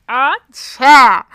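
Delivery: big storyteller's outburst; dramatic exclamation. Real, not acted. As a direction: exclaim it like juicy gossip. Exclaims over the coming blow-up.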